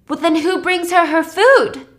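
high, loud retold alarm; alarmed question. Virgil learning nobody has visited Claire, alarmed. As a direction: ask it loudly with rising worry.

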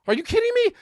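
can't believe it; incredulous. Real, not acted. Comic telling audience, high disbelieving.

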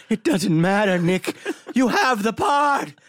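high, loud, slowed parting shout; high mock shout. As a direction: yell it back while running off. Retold line thrown at Nick.

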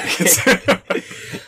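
airy burst of laughter; breathy laugh. Real, not acted. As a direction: laugh out, unvoiced and high. Host laughs at guest's joke.

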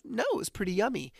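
put-on high, sweet little-boy voice; child's voice. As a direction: play a happy small kid. Son voiced, cheerful.

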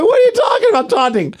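very high-pitched incredulous protest; indignant protest. Retold or imitated. Wrestler disputing the ref's flag, high indignant protest. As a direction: squawk back at the call in disbelief.

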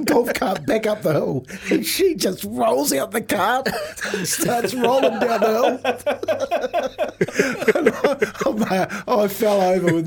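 can barely get it out for laughing; laughing while telling. Real, not acted. Laughing retelling wife rolling out.

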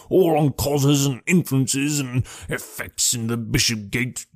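wheezy, blustering detective; puffed-up, wheezy character voice; wheezing. A narrator's reading. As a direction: hold forth in a husky wheeze, with a chuckle. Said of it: "he wheezed".

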